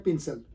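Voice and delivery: "पेंसिल" as PIN-sul; short i first, weak second vowel in पेंसिल; wrong pronunciation. Retold or imitated. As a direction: say पेंसिल like "pinsal". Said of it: "not पेंसिल".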